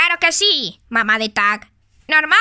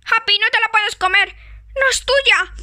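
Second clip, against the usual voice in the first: shouting to stop; 7 semitones higher than usual. Pretend. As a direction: yell at Happy not to eat the cookies. Stops friend eating cookies.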